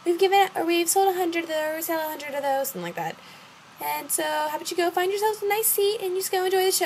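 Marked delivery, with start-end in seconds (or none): 0.1-2.7 high-pitched mock staffer voice; imitated staffer. Quoting event staff to her. 3.8-6.9 high, singsong mock staffer voice; imitated brush-off.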